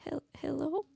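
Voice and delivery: put-on small, high, soft child's voice; a tiny, hesitant call from a little kid. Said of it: "you hear this little voice".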